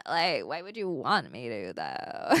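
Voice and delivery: put-on low, creaky Valley Girl with vocal fry; Valley Girl accent. Streamer shows chat a Valley Girl accent, in low vocal fry. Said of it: "Can I do a Valley Girl accent?".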